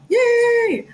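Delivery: very high, loud, drawn-out yay; excited cheer. Real, not acted. Host breaks off mid-sentence to cheer her viewers, excited. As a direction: burst out cheering.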